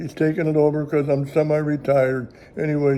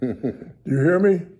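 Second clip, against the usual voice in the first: slow, pointed warning, a hint of a scolding wife; stern scolding. Wife at the restaurant warning her husband, slow mock stern scold. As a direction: lay down the law, slow and firm.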